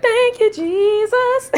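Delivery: a very high, mock-grateful cry; relieved praise. Mother retelling relief when babies held their bottle, very high. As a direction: throw it up like a prayer of relief.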